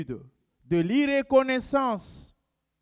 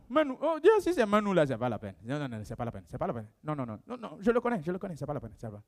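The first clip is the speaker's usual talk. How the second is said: low, much softer scornful brush-off; dismissive gossip; 6 semitones lower, 6 dB quieter, much faster than usual. Retold or imitated. Congregant voiced rejecting Manu for a post, low and scornful. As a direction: wave Manu away with contempt.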